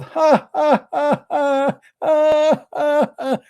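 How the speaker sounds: high mocking devil laughter; devil's laugh. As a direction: cackle like a devil in someone's mouth. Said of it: "he will start laughing".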